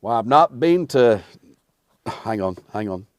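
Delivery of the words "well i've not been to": loud, lightly played cowboy; Western character. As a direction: launch into a mock Western voice. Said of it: "I could play any Western character".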